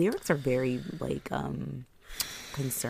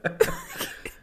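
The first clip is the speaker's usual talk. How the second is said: high giggle. Real, not acted. Laughing at own joke.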